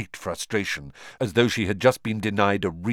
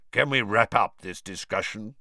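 growled. A narrator's reading. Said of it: "growled Moody".

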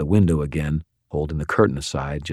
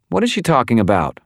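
higher-pitched, indignant demand; 7 semitones higher, 4 dB louder, faster than usual. A narrator's reading. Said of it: "Priscilla demanded".

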